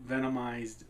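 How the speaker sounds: ordinary talk, nothing marked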